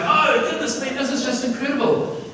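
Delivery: heaven's crowd praising creation; awed angels. Acted. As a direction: gush in wonder. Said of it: "all these animals ... going".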